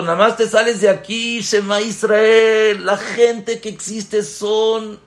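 pleading father. Acted. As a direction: plead with your son, stretching out the words. King warns his wayward son.

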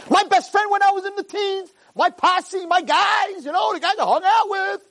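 high-pitched yelled list of buddies; mock scream. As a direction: holler it as an absurd deathbed cry. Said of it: "They're not screaming".

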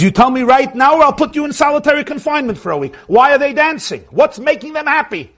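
high, pressing mock threat; retold furious warden. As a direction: demand an answer as an angry warden. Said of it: "he's astounded and he's furious".

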